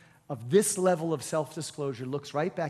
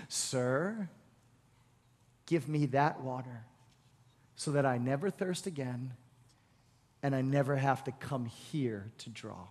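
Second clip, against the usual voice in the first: softer and lower, humbled and asking; changed, softer tone; 5 semitones lower, 5 dB quieter than usual. Retold or imitated. Samaritan woman softly asking Jesus for water, hushed. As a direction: ask quietly, tone suddenly softened. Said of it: "she kinda changed her tone".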